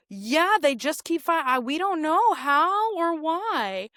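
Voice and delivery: slow, high, drawn-out cluelessness; mock-innocent puzzlement; feigned ignorance. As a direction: play dumb, stretching the words. Mimics newspapers feigning ignorance.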